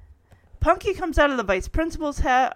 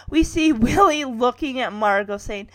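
ordinary talk

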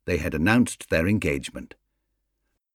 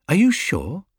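a lightly voiced, doubtful Mrs Brown, pitched higher; surprised doubt; 9 semitones higher than usual. Mrs Brown doubting Paddington's usher news, mildly surprised. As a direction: ask it in mild disbelief.

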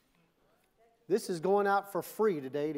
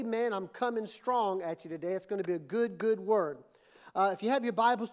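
ordinary delivery

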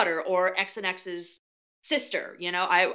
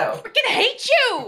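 playful shout; 7 dB louder than usual. Real, not acted. Playful envy over Trinity tickets.

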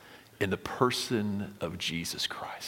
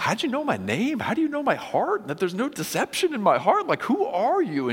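much higher, amazed and eager; excited; 13 semitones higher, 4 dB louder than usual. Retold or imitated. Nathanael amazed that Jesus knows his heart, excited. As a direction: gush like a stunned new follower. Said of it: "Nathanael's gonna get all excited".

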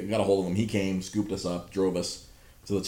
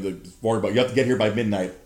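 usual speaking voice

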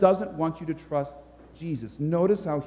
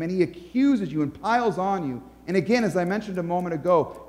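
ordinary talk

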